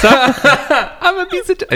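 very high, slow laughing cartoon voice; squeaky goofy alien giggling; Jar Jar Binks. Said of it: "Jar Jar Binks".

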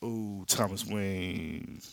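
slow, drawn-out mock "ooh"; mock awe. Comic fan imitating the big reveal, mock awe. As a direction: stretch it out as an underwhelming big reveal.

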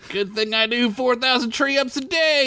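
high forced bravado; fake confidence. Derek showing off to Squeak, faking confidence. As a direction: bluff it casually. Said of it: "faking confidently".

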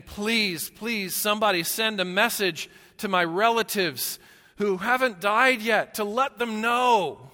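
high, loud pleading cry toward heaven; the rich man in torment calling up to heaven for help. Retold or imitated. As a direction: beg desperately, voice straining up high. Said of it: "he's crying out toward heaven".

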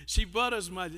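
soft, low, slow fond teasing; teasing tenderness. Real, not acted. Preacher fondly teasing his wife before the church. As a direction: tease her gently and lovingly.

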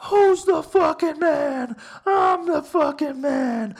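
loud, high wrestler's boast; full-force bragging yell; WWE promo. Retold or imitated. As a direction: shout it like a wrestling promo, stretching the words. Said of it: "I cut a fucking W W E promo".

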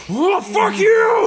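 high loud slow mock taunting yell. Retelling a girl taunting a gorilla, high loud yell. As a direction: shout it at the gorilla. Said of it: "the girl's like".

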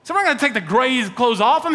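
ordinary talk

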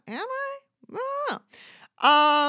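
a sudden, high, fake cry; mock sob at herself; mock anguished wail. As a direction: wail the question like a breakdown. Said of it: "sudden mock wail".

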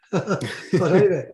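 laughing through the words; chuckling as he moves on; real laugh breaking into the line. Real, not acted. Laughs recalling being flattened.

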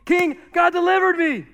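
high, loud acted holler; calling out. Daniel shouting to the king from the den, acted jubilant call. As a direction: holler it up to someone far above.

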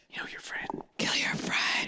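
unvoiced eerie whisper; whispered spirit voice. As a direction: breathe it like a spirit. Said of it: "not a very good Bigfoot voice".

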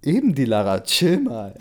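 laughing through a hammed-up retort; amused mock exasperation. A narrator's reading. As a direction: blurt it out, about to crack up. Cracks up over acted line.

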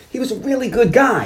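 high-pitched, put-on interviewee voice. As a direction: vouch for someone in a high, emphatic impression. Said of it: "[name] talking about the Gong Show".

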